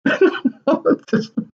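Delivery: high, breathy cackle; Joker-like laugh. Real, not acted. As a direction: let a high manic Joker laugh slip out. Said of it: "It's like my Joker's coming out again".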